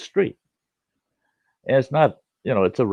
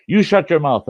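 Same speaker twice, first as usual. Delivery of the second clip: louder, higher scolding snap; angry neighbor; 9 semitones higher, 7 dB louder than usual. Retold or imitated. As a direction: bark it at the kid.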